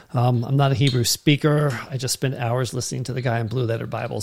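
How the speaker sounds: ordinary delivery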